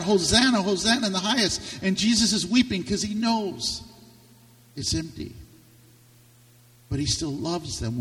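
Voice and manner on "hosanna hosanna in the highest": calls out Hosanna loud and high; a preacher voicing the crowd's joyful cry. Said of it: "they're gonna cry out".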